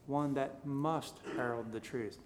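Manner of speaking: slow, with a drawn-out weight on "must"; low, deliberate delivery with "must" picked out; stress on must. Shown as a demonstration. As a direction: lean hard and long on "must", the rest measured. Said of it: "I'm putting the emphasis on".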